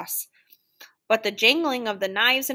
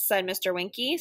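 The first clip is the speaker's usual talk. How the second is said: plain reading voice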